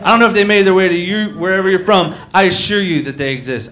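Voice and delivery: ordinary delivery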